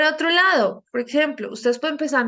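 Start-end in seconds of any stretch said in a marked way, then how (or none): none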